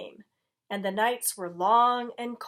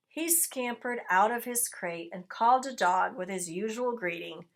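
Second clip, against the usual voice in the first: plain reading voice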